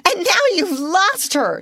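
high, frantic accusation; wild cry. Acted. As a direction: cry it out wildly at her sister. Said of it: "she cried wildly".

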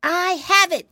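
put-on piggy boy struck by a plan, crying out; eager cry. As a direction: shout out the sudden idea. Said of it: "he cried".

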